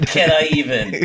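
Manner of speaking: mock-echoing through laughter; laughing echo. Real, not acted. Co-host teasing Joe's hesitation, laughing. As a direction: tease him by repeating it while laughing.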